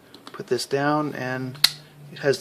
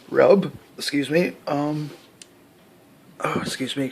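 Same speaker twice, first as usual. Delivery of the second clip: talking through hiccups. Real, not acted. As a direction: catch a hiccup between words. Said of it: "got the hiccups".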